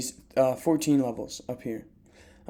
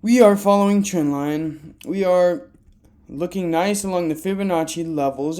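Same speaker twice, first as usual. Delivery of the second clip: ordinary talk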